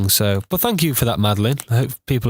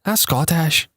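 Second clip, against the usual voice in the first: higher-pitched mock Scottish; teasing imitation of a Scottish accent; 6 semitones higher, slower than usual. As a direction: mimic a friend's accidental Scottish. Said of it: "you said that in a Scottish accent".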